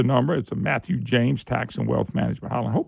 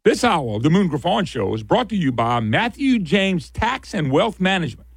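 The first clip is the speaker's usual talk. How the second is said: sponsor read; 4 semitones higher than usual. Acted. Host reads the sponsor tag.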